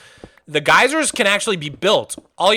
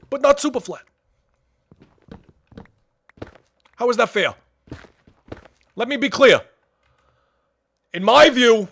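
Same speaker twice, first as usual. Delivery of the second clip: Bernie Sanders impression; 5 semitones higher than usual. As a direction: rail like a fiery old senator. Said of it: "hear Moggy Sanders".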